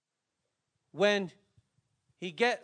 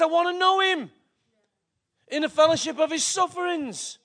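proclaiming Paul; 6 semitones higher, 7 dB louder than usual. Acted. Paul quoted, loud.

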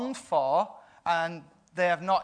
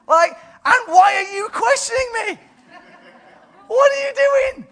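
far higher, louder incredulous outburst; exasperated disbelief; 10 dB louder than usual. Acted. Preacher scolds doubting Zechariah, exasperated. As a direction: throw up your hands in mock disbelief.

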